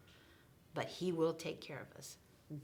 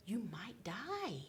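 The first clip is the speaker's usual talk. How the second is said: usual speaking voice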